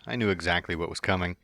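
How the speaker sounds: plain reading voice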